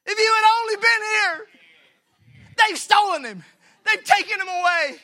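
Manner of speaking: high, loud, anguished lament; despairing cry. Acted. Mary in the depths of despair, wailing loudly. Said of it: "go right to the depths of despair".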